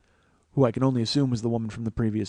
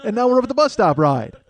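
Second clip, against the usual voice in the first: put-on higher, louder, thrilled kid brother; eager five-year-old, higher and louder; excited little kid; 6 semitones higher, 7 dB louder than usual. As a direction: imitate a little boy loving every ride. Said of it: "He's like".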